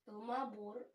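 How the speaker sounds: "Ilmabor" in a German accent, with a rolled r at the end. Shown as a demonstration. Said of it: "uh, German accent".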